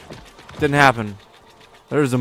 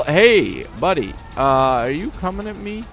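normal talking voice

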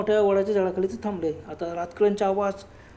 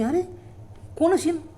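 startled question; 9 semitones higher than usual. Acted. Friends startled by movement in tree.

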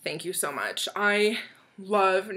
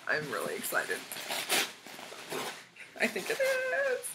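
silly voice swings; 5 dB quieter than usual. Real, not acted. Low then high squeal.

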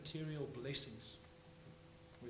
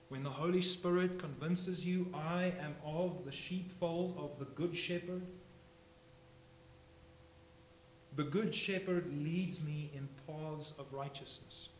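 loud, raised sermon voice; fiery preaching; 4 dB louder than usual. Acted. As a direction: drive the questions home to the congregation. Preaching at full force.

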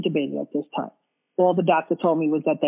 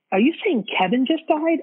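much higher, disbelieving retold question; shocked question; 9 semitones higher than usual. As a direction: imitate the nurse's shocked, high-pitched reaction. Retold nurse hearing of the death.

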